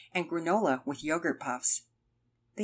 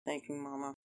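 very soft, low grudging thanks; barely audible mumble; 3 semitones lower, 13 dB quieter than usual. A narrator's reading. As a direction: mumble it under your breath. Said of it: "mumbled a barely audible".

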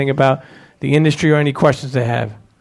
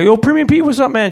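mock call-out; 8 semitones higher than usual. Fan at the sneaker event greets Pete with a high call. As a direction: hail him like an excited fan at the door. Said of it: "somebody's on the side of me".

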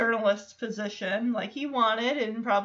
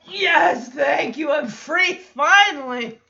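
loud, laughing cheer of relief; relieved cheer; 4 semitones higher, 8 dB louder, slower than usual. Retold or imitated. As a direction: celebrate your escape out loud. Stephanie relieved to be free.

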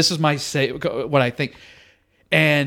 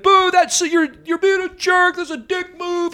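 high-pitched imitation of booing hecklers; jeering crowd; 13 semitones higher than usual. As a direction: jeer up high like an indignant audience. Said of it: "Now people are like".

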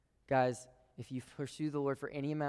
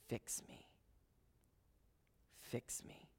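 whispered plea; 13 dB quieter, slower than usual. Acted. Pleading prayer voiced.

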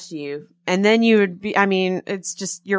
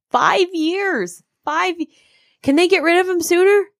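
high, gleeful disbelief; thrilled rival fan, pitched way up; incredulous; 11 semitones higher than usual. Real, not acted. Rival coach's long contract.

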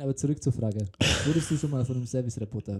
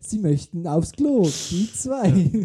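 loud, higher, slow menu voice; automated phone menu; 6 semitones higher, 10 dB louder, slower than usual. Pretend. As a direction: read options like a hotline bot.

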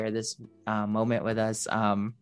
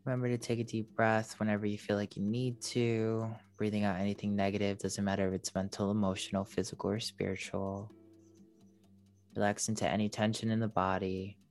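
airy breathy mystical healer; 6 dB quieter than usual. Acted. Leads a meditation.

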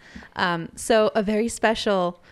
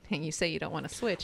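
muttered aside; 5 semitones lower, 9 dB quieter, faster than usual. Acted.

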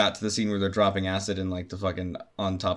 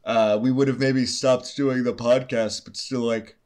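talking through a yawn; words stretched by a yawn; 3 semitones higher than usual. Real, not acted. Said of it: "I'm yawning immediately".

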